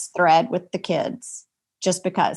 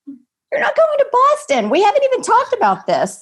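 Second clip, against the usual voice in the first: much higher, louder parent shout; parental outburst; 6 dB louder than usual. Retold or imitated. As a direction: shut the teenager down with a sharp outcry. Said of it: "And we're like".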